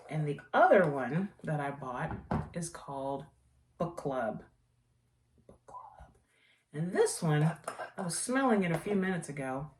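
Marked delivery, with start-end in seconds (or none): 5.5-6.1 breathed as a hushed whisper. Shown as a demonstration. Naming the candle to viewers in a whisper. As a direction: whisper the name like a secret.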